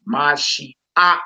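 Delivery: Mashiach broken into ma-shee-AK; pronunciation shown. Shown as a demonstration. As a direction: sound out Mashiach, ending on a separate AK. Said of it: "it's spelled like that".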